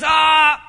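loud drawn-out mock wail; imitated anguished cry. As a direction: cry out as if imitating a heartbroken man. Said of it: "there's that breaking of his heart".

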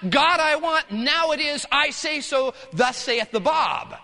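loud, high-pitched decree to God; hammed-up commanding proclamation; mock demanding prayer. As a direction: order God about, pompously. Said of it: "It's not".

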